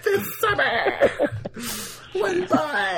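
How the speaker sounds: very high, squeaky, drawn-out trailer voice; sister's trailer imitation. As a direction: mimic a little girl's epic trailer read. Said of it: "she's like".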